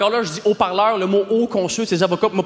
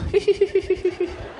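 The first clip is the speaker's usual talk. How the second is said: high-pitched put-on giggle; mock giggle; 8 semitones higher, 3 dB quieter than usual. Comic imitating girls who love funny guys, mock giggle.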